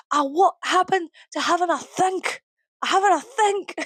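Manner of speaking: high, indignant mock-Scottish voice; bad Scottish accent. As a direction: imitate an incredulous Scot, pitched high. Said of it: "I can't do a Scottish accent".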